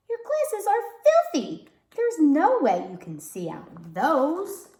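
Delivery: high, loud mock scolding; exasperated mom. Mom telling daughter her glasses are filthy, exasperated scolding. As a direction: scold the girl playfully.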